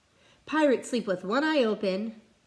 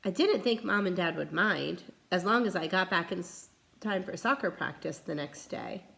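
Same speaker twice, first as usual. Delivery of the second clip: ordinary delivery, nothing marked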